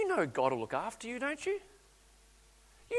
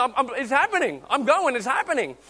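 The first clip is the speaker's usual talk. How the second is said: put-on higher, louder thrilled child; excited kid; 6 semitones higher, 5 dB louder than usual. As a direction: burst with joy at riding alone. Child thrilled riding alone.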